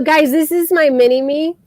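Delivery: ordinary talk, nothing marked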